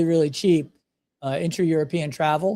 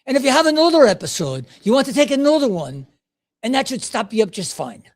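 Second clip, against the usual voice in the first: high, hammed-up Irish lilt; attempted Irish accent; 5 semitones higher, 3 dB louder than usual. Pharmacist explaining the pills in detail, attempted Irish accent. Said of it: "The Irish accent, the guy holding it up".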